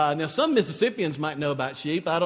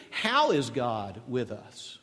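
ordinary delivery

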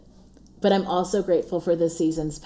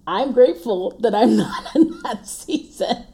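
laughing; 5 semitones higher, 5 dB louder than usual. Real, not acted. Relieved not to homeschool.

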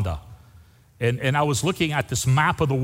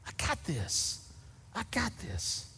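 breathy whisper, I got this; Jesus whispering reassurance to his disciples; 4 semitones higher, 14 dB quieter than usual. Acted. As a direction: hush it like a secret comfort. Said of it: "that's what Jesus is saying".

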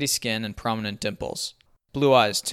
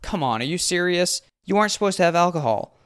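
high, pleading whine, getting louder; exasperated whiny complaint, pitched way up; whining; 8 semitones higher, 4 dB louder than usual. A narrator's reading. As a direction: whine like a powerless camp counselor. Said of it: "the warden whines".

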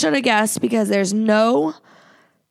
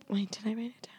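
whispering; 12 dB quieter, faster than usual. Real, not acted.

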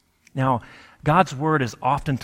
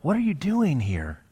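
higher-pitched, urgent appeal to God; pleading prayer; 5 semitones higher than usual. Acted. Preacher voicing a prayer to get out of a situation, pleading. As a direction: plead upward, as if wanting out. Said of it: "it's okay in those moments to pray".

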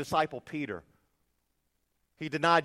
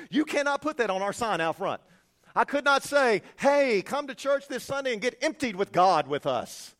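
louder, higher, mock cheerful advertising; mock sign pitch; 9 semitones higher, 10 dB louder than usual. Preacher to congregation, mocking a church sign, bright and loud. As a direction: sell it like a cheesy church ad.

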